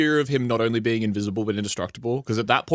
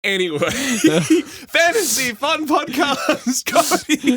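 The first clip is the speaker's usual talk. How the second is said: high, slow laughing podcast intro; laughing intro shout; 5 dB louder, much slower than usual. Real, not acted. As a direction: shout the show name through laughter. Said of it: "show intro, laugh shout".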